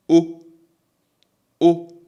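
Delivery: O held steady, loud and high, no glide; pure O sound. Shown as a demonstration. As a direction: sing out a single pure O. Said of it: "this is a pure O sound".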